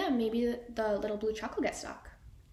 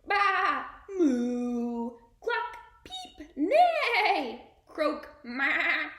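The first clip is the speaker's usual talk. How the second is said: a quick chorus of calls; animal noises; 4 semitones higher, much slower than usual. Acted. Animals climbing into the truck, one call after another. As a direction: make each animal sound.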